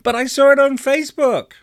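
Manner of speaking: put-on very high, loud indignant kid; offended. A narrator's reading. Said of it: "getting offended by all of these questions".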